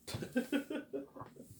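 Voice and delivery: high breathy mock sob sound; airy falsetto wail. Mocking imitated crying.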